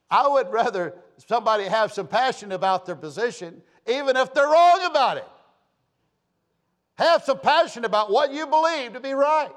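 loud, high pulpit delivery; fired-up preacher driving the point home; impassioned preaching. Real, not acted. Preaches with rising passion.

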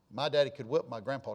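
normal talking voice

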